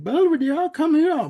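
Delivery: high, loud sing-song call to a dog; voices. Retold or imitated. Disguised dog calling sweetly to lure Belvedere, high and loud. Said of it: "I didn't mean to do the voices".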